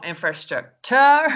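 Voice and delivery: infrastrucTURE, last syllable loud and rising; hammed-up wrong "infrastructure", punching the final TURE; misplaced word stress. Shown as a demonstration. Teacher showing another way some people say it, misplaced word stress. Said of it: "some people say infrastructure".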